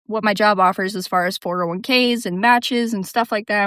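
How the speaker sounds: ordinary talk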